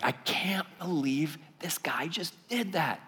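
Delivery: slow, whispered amazement; hushed, drawn-out disbelief. Retold or imitated. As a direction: whisper it slowly, stunned, as if to yourself. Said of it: "still just in disbelief".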